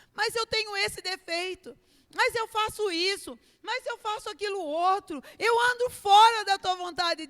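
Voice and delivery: loud, high tearful confession; a believer crying out to God about their faults, tearful shout. Retold or imitated. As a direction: cry out your faults with a breaking voice. Believer confessing, cry shout heard.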